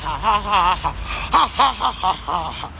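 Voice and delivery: low mocking ha-ha, fading out; mocking laugh. Real, not acted. Laughing after her rant.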